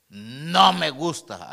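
imitated defiant child, loud and drawn out; tono desafiante. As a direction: mimic a son's stubborn refusal, slow and loud. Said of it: "así, con ese tono". (imitando a un hijo rebelde, fuerte y alargado)

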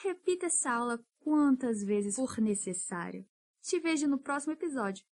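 ordinary talk, nothing marked

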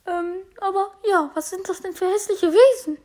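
a high, sneering lady's voice; Piper voice. Acted. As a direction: look down your nose at the strange creatures. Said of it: "Oh, Piper kommt".

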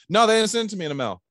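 high, loud, quick denial; protesting client. Acted. Client telling the salesman nothing came, high protest. Acted client denying on phone.

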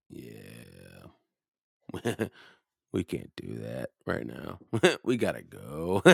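low, laughing mom voice. Retold or imitated. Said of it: "Her mom was like".

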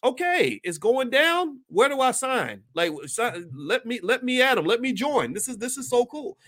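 can't wait to join; playful imitation of an eager rebel; eager volunteer.

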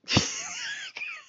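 sudden high laugh; burst of laughter. Real, not acted. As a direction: crack up at the memory. Laughing about getting high.

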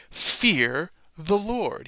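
slow, high and loud "Fear the Lord"; drawn-out preaching command; solemn command. Acted. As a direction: command it like a prophet.

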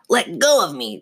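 high, snarling puppet voice; evil dummy demand. A narrator's reading. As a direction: demand release like an angry puppet. Dummy struggles to get free.

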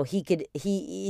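usual speaking voice

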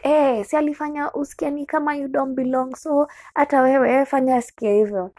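friends egging on. Retold or imitated. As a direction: egg her on like the gang did. Voicing friends egging her on.

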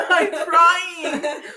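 words squeezed through loud laughter; laughing. Real, not acted. Laughing while trying to speak.

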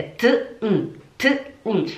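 T then a closed N, separately; isolated sounds. Shown as a demonstration. Teacher breaks down the ending, separate T and hummed N. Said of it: "a gente não pronuncia".

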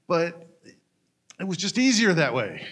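ordinary delivery

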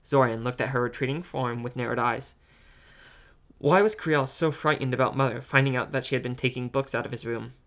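usual speaking voice

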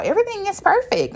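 very high, quick sing-song fake brightness; high, fast, chirpy mock reassurance; mock cheerful coworker. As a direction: parody the coworkers pretending all is fine.

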